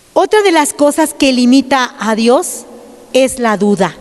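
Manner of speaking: ordinary delivery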